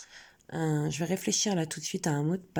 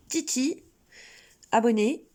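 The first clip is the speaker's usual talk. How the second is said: emphatic reminder; 6 semitones higher, 5 dB louder than usual. Real, not acted. Reminder shouted at viewers.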